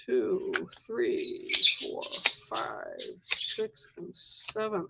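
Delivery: counting cards under her breath, unvoiced; whispered counting. Real, not acted. Reader counting cards softly to herself, whispered. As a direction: hush it, just counting to yourself.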